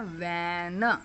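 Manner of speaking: वैन stretched, with the final n split off; long vowel sound. Shown as a demonstration. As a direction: sound out वैन slowly as vae-nuh. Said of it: "ए long sound की आवाज़".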